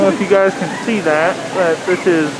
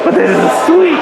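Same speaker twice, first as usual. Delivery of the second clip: excited; 11 semitones higher than usual. Real, not acted. Excited reaction to Lego set.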